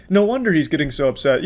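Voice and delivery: ordinary delivery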